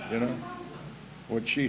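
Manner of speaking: ordinary talk, nothing marked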